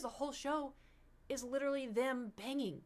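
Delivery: ordinary talk, nothing marked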